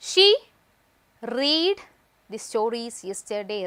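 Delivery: drawn out word by word; slow dictation voice; very slow read-out. Shown as a demonstration. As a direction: dictate it slowly to the class. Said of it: "reading the sentence aloud".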